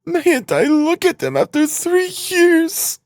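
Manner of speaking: very high, drawn-out wail; tearful mother. A narrator's reading. Mother begging to look at her children, tearful high plea. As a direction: plead as if weeping, slow and high. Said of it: "wept Pulcheria Alexandrovna".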